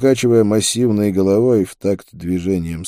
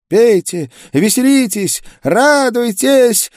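shrill frantic woman's cry, far above the narrator; high breaking female voice; 5 dB louder, slower than usual. A narrator's reading. Drunken half-naked girl calling out to the street, high breaking voice. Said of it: "Высокий, срывающийся женский голос".

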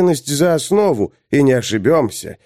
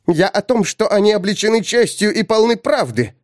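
exasperated; 8 semitones higher than usual. Acted. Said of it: "взвилась Огла".

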